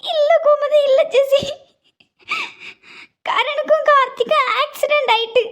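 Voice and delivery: blurting the bad news in distress; upset and urgent; distraught. Mother breaks the news.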